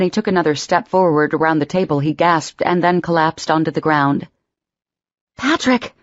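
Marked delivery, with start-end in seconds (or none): 5.4-5.9 higher, sudden cry of his name; cry of alarm. Acted. Emma crying out as Patrick collapses, alarmed. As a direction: call out to him as he collapses. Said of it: "Emma cried".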